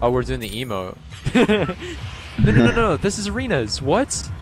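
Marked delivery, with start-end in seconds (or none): 1.1-2.3 high burst of laughter. Real, not acted. Laugh at teammate's emote. 2.4-4.3 high alarmed protest; sudden high-pitched panic. Real, not acted. Panicking about wrong mode.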